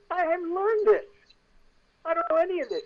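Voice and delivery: very high-pitched retold panic; acted squeal of unreadiness; panicked protest. Actor handed star's script, panicked protest.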